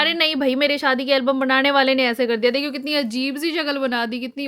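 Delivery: loud, high laughing denial; exasperated, laughing denial; animated protest. Real, not acted. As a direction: brush off the teasing loudly. Mother protests daughter's lipstick remark.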